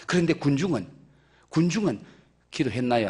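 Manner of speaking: usual speaking voice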